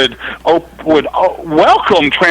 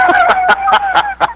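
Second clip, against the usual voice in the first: high squealing laugh. Real, not acted. Caller cackling at own story.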